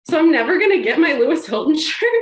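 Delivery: high, loud comic despair; mock wail. Retelling her reaction to the refund, loud mock despair. Shirt refused, she wails.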